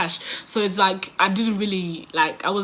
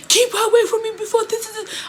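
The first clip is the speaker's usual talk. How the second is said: panicked plea; 11 semitones higher, faster than usual. Retold or imitated. Guy panics at angry girl.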